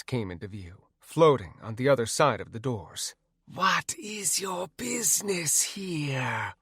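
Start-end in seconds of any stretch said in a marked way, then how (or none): 3.4-6.5 slow, hissed ghostly question, a little softer; hissing wraith. A narrator's reading. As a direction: hiss at the strangers like a guard wraith. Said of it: "one of them hissed".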